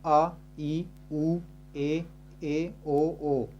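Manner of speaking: long vowels आ, ई, ऊ named one at a time; reciting long vowels. Shown as a demonstration. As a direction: list each long vowel clearly for the class. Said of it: "सात कौन से?". (दीर्घ स्वर साफ़ गिनाना)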